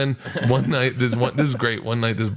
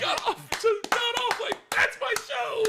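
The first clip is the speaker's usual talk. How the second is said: squeaky high voice. Retold or imitated. Comic repeats his exit line, very high squeaky voice. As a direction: squeak it out like a cartoon.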